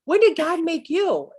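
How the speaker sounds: high, startled voice of a dead sister; a puzzled, startled question; mock surprise, pitched high; surprised sister. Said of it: "looked at my friend and goes".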